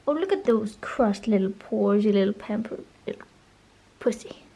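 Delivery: low hushed cooing at cat; soft cooing. Real, not acted. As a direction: coo softly to the cat.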